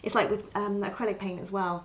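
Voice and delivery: usual speaking voice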